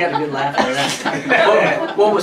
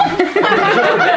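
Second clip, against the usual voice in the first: sudden high laugh, much louder; high burst of laughter; 6 dB louder than usual. Real, not acted. Laughing at own story.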